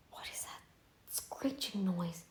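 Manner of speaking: hushed whisper about a strange noise; real whisper. Real, not acted. To herself, noticing a screeching noise, whispered. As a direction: breathe it out, unvoiced and low.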